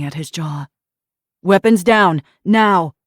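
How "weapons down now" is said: loud shouted order; shouted command. Acted. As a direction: shout it hard. Officer shouting.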